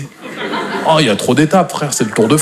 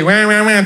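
high mock baby crying; cartoonish newborn cry, pitched up; imitated baby wail; 7 semitones higher, slower than usual. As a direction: wail ouin ouin like an infant.

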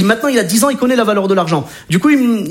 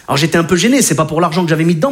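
normal talking voice

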